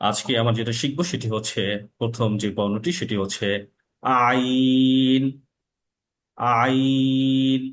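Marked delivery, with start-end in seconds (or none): 4.3-7.6 "আইন" pressed hard from the middle of the throat and drawn out; "আইন" with a tight, pressed throat sound; throaty ain. Shown as a demonstration. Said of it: "আইনের শব্দটা শক্ত এবং চাপ দিয়ে করতে হবে".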